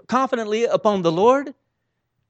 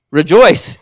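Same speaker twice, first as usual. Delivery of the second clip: shouted, much louder rejoice; loud call; 11 dB louder than usual. Shown as a demonstration. Preacher calling a quiet congregation to rejoice. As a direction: bellow rejoice to wake them. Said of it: "It's very quiet in here".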